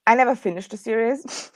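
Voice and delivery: usual speaking voice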